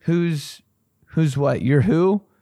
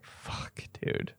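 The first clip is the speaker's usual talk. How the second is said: whispered dread; 17 dB quieter than usual. Acted. Dread reaction quote.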